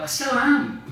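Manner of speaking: high-pitched, laughing admission; amused confession. Real, not acted. Writer joking to audience about old fright, amused. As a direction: let a laugh lift the voice right up.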